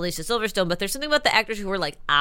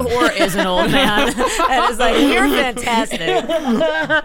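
laughing while talking; 3 semitones higher, 5 dB louder, slower than usual. Real, not acted. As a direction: giggle through the words. Laughing, voicing old man.

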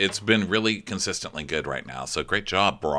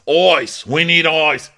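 louder, higher call for ice; mock urgent captain; 8 semitones higher, 9 dB louder, slower than usual. Host mimicking the captain calling for ice. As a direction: bark out the order for ice. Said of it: "the captain's like".

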